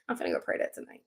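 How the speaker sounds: whispered, under her breath; whispered aside. Real, not acted. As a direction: slip it in as a whisper.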